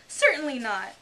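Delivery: loud, high indignant denial. Acted. Alice rejecting the idea of washing lessons, indignant. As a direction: deny it, thoroughly offended. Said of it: "said Alice indignantly".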